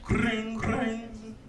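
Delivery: high trilled phone ring; rolled, high-pitched ring noise. Pretend. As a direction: imitate a ringing telephone. Imitates a phone ringing.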